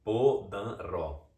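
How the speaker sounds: Podanró drawn out slowly, syllable by syllable; Podanró in slow, deliberate syllables, Po, dan, ró; invented name sounded out. Shown as a demonstration. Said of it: "se obtém o nome".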